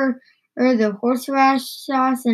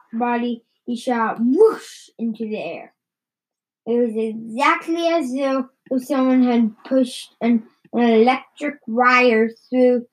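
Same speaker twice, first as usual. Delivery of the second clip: plain reading voice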